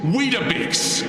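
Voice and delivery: slow, high mocking Weetabix; mocking repeat. Mocking the Brit's only boast, slow and high. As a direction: stretch Weetabix. out slowly in ridicule.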